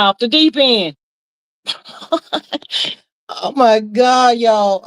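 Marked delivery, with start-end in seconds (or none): 2.0-2.9 high airy giggle, half-stifled; breathy laugh. Real, not acted. Streamer laughing over upsetting news. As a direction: laugh to keep from crying. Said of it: "I'm laughing, keep from crying".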